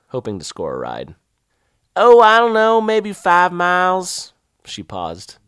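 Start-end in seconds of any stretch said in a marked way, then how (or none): 2.0-4.3 slow, high Southern woman voice; old Southern woman. Retold or imitated. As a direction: drawl it like a country lady. Voicing the local woman.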